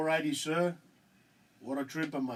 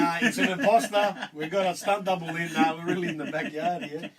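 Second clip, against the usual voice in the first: laughing; 4 semitones higher, 8 dB louder than usual. Real, not acted. Joking about an imposter guest.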